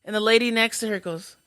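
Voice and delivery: normal talking voice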